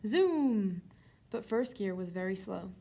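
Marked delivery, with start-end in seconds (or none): 0.1-0.8 short, loud zoom; zooming noise. A narrator's reading. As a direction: imitate a wheelchair setting off. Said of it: "rode up and down the driveway".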